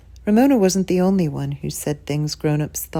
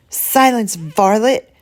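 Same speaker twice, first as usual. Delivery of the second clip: lofty, louder mock-old-fashioned command; mock grand command; 4 semitones higher, 5 dB louder, slower than usual. A narrator's reading. Older sister to Ramona, a louder hammed-up lofty order. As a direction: lord it over her little sister. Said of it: "commanded Beezus".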